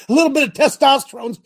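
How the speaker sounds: loud and high cheer for testosterone; pumped rally shout; hyped-up call. Pretend. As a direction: belt it like a rally crowd. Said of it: "Somebody give me a thumbs up, say".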